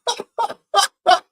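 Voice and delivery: giddy high laughter; helpless burst of a laugh. Real, not acted. Streamer cracking up at the goal, high laugh. Funny deflection.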